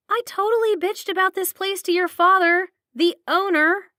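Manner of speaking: loud, high embarrassed wail; mortified outburst. Acted. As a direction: cringe out loud at yourself. Embarrassed outburst after groan.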